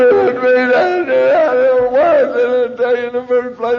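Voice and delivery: high, loud words wrenched out through sobs; sobbing confession. Retold or imitated. Confessing to the AA woman that it was him, sobbing. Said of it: "Could not stop sobbing".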